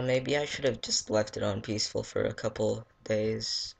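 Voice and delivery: normal talking voice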